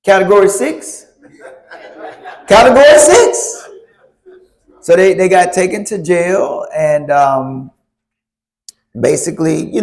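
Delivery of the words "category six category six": laughing. Real, not acted. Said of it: "what category is that, Pastor?".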